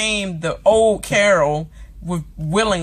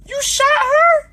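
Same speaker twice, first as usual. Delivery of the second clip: much higher, loud, sobbing disbelief; imitating Rick crying out in shock; crying shout; 4 dB louder than usual. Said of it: "was just crying like".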